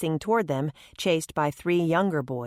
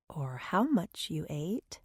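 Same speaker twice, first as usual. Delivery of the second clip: much softer, slow, dry aside; inner thought; 7 dB quieter, slower than usual. A narrator's reading. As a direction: think it quietly to yourself. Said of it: "Maddie thought".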